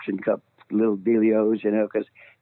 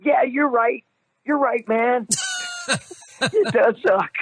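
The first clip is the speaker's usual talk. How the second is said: nervous agreeing; 12 semitones higher, slower than usual. Retold or imitated. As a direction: blurt eager nervous agreement. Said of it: "And I'm on the recording going".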